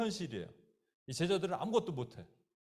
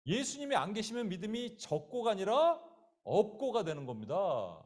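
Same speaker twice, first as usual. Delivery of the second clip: ordinary delivery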